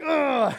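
loud, high mock groan; exasperated groan. Dad reacts to the returning moan, exasperated groan. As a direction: groan out a hammed-up "ugh".

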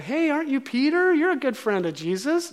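put-on high girlish voice, accusing; little girl voice. Little girl confronting Peter, high child voice.

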